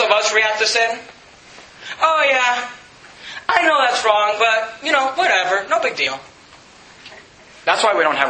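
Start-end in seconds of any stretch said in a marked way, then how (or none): 2.0-6.2 shrugging it off. Pretend. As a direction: brush sin aside like it's nothing. Voicing a careless believer's shrug.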